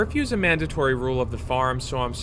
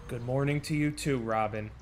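sarcastic sigh; 3 semitones lower, 8 dB quieter than usual. Acted. Late student, teacher sighs sarcastically.

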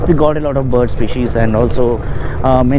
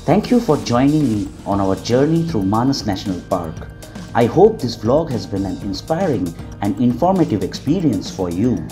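calm, polished closing narration; voiceover narration; 2 semitones lower, 7 dB quieter than usual. Acted. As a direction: read it like a documentary outro.

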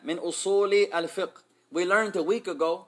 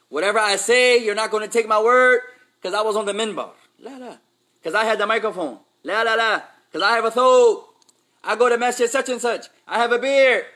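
loud, higher preaching push; emphatic mock boast; 5 semitones higher, 9 dB louder than usual. Teacher mocks a pompous preacher's claims to students, loud and emphatic. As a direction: hammer home the mock arrogance. Mocking an arrogant preacher's authority.

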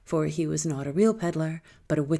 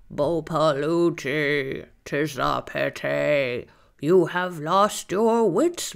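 slow, croaky bird voice, drawn out; croaking crow; much slower than usual. A narrator's reading. Crow croaking its warning to the girl, slow and croaky.

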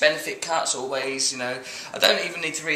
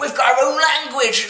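higher-pitched mock Cornish accent; comic Cornish accent; 7 semitones higher than usual. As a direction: boast in a put-on Cornish accent. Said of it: "Can you do a Cornish accent?".

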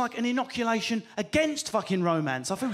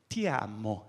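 low, soft Italian endearment; romantic Italian; 10 semitones lower, 6 dB quieter, slower than usual. Shown as a demonstration. Showing how beautiful love sounds in Italian, low romantic Italian. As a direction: breathe out a tender Italian declaration. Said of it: "how beautiful".

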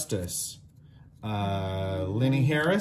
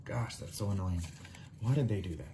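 whispering; 6 semitones lower, 11 dB quieter, faster than usual. Real, not acted. Grumbling to himself over upside-down cards.

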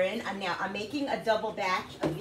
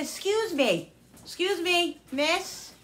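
flag her down across the aisle; calling out; 10 semitones higher, slower than usual. Retold or imitated. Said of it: "So I said".

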